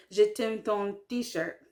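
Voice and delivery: "t-shirt" as "tee-shirt" inside the French; French sentence with "t-shirt" kept as "tee-shirt"; English loanword in French. Shown as a demonstration. Learner giving an example sentence, "t-shirt" said "tee-shirt". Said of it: "if you want to say".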